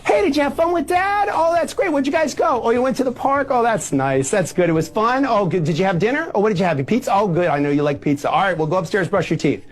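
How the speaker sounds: high, easy-going parent chatter; upbeat supportive parent. Shown as a demonstration. Parent welcoming a child back from dad's, high and cheerfully accepting. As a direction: greet the child happily and breeze through the questions. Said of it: "'cause here's the difference".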